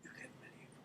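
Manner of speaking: breathy whisper, almost silent; whispered repeat. Real, not acted. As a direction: drop to a hush for the repeat. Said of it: "repeated to the guys".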